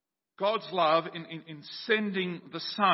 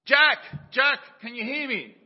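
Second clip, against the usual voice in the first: calling out; 9 semitones higher, 10 dB louder than usual. Acted. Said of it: "he heard a voice".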